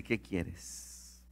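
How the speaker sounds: whispered, soft ¿Qué quieres? as Jesus; hushed question. Retold or imitated. Jesus quietly asking the blind man, whispered. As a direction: breathe the words ¿Qué quieres? gently.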